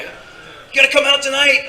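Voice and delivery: high, loud, drawn-out imitation; eager urging. Club friend urging the actor out, eager high voice. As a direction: push the invite, high and slow.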